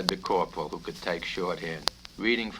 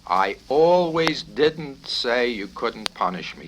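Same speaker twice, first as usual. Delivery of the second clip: slow, deliberate, each word placed; careful answer; 4 semitones higher, 7 dB louder, slower than usual. Acted. Cadet answering the colonel's trick question, slow and careful. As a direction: choose every word with care. Clevenger took a deep breath.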